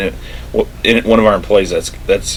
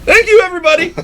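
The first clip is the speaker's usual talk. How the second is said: very high, showy thanks; Michael Scott; 3 dB louder than usual. Retold or imitated. Imitating a boss leaving the meeting, high flourish. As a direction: call out a triumphant exit line. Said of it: "And he's like".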